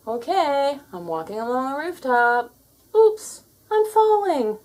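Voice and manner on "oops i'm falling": higher, louder hammed-up fright; playful pretend panic; mock alarm. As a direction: fake a sudden fall for fun. Cat pretends to fall off roof.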